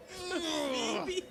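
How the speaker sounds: repeating the name through a laugh. Retold or imitated. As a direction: laugh while calling the name. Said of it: "she's like".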